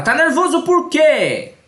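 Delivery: high, slow, mocking mother's question; mother scolding. Mother questioning her nervous child, high and impatient. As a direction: ask it like an impatient mom, drawn out and high. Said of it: "e a mãe fala".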